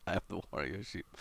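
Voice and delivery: soft, quick, giggly read; words dissolving into giggles; laughing through it. Real, not acted. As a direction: read it while still laughing. Reading quote while laughing.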